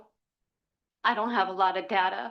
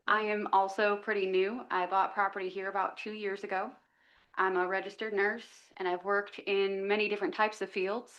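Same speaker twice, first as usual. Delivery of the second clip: ordinary talk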